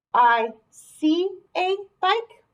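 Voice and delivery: slow, drawn-out teacher reading; slow sentence read for young pupils; slow trial read. Shown as a demonstration. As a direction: read it slowly, testing each word. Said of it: "That seems to make sense".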